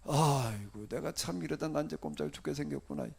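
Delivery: low, sighing acted lament. Preacher voicing Baruch fearing he is doomed, low lament. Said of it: "뭘 탄식한 거지요".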